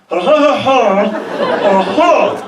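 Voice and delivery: nervous, high ha ha ha laughter; giddy laughter. Retold or imitated. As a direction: laugh it off nervously with a numb mouth. Laughing to receptionist, numbed.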